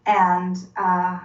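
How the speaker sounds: normal talking voice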